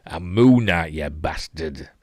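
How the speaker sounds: put-on Sean Bean Yorkshire accent. Vlogger imitating Sean Bean's Yorkshire accent. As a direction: drop into a northern Yorkshire voice. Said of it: "Should have got Sean Bean in".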